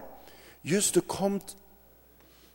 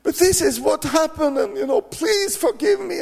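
high, wailing plea, much higher; pleading forgiveness; 10 semitones higher than usual. Retold or imitated. Friend begging the preacher's forgiveness, wailing high. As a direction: beg like a broken man. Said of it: "He's so saying".

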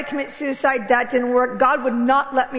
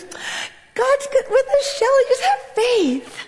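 high, slow, soothing husband; husband's gentle voice; 12 semitones higher, slower than usual. Retold or imitated. Husband reassuring his bride, high and slow. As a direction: coax her gently to have faith. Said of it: "He goes".

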